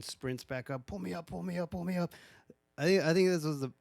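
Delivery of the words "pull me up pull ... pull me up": high-pitched mock panic, urgent repeats; panicked plea. Man on a rope calling to be hauled up, mock panic. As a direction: imitate frantic begging without shouting.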